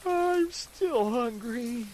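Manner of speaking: slow, high, loud wail; drawn-out yawning whine of hunger; high, loud and slow, a miserable wail. Acted. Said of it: "yawned deeply and wailed".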